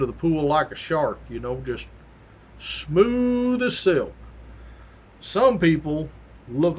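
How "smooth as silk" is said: long, high held glide on "smooth"; very slow, gliding delivery; drawn out smoothly. Shown as a demonstration. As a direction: stretch it out slowly like a smooth glide. Illustrates swimmers gliding like a shark.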